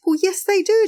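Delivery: mother sweetly insulting the taxi driver; sugary sarcasm. Retold or imitated. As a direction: sing it out sweetly to land the jab. Mother's sweet cutting punchline.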